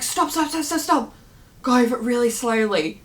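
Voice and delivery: высокий, встревоженный крик «стоп» в пересказе; begging to stop. Retold or imitated. Begging partner at speed bumps.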